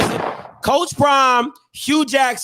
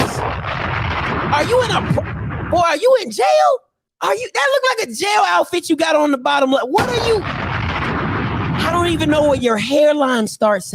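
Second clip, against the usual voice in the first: mock roasting shout; 5 semitones higher than usual. Roasting a coach's photo for viewers, high mock yell. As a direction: heckle him like a roast crowd.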